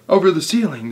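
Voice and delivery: high puzzled cry. A narrator's reading. The helpers query Mugglewump's odd order, crying out. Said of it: "they cried".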